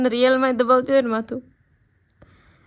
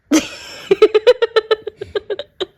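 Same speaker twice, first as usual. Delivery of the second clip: laugh; 11 semitones higher, 4 dB louder than usual. Real, not acted. Laughing at husband.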